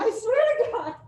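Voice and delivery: laughing, high exasperated cry; half-laughing, throw up your hands; a laughing mock outburst at the student; laughing exasperation. Real, not acted.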